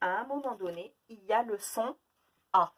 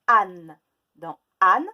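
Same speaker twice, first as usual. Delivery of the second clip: âne dit très fort; mot appuyé; 13 dB louder, slower than usual. Shown as a demonstration. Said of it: "quels sont les sons du mot". (mot martelé)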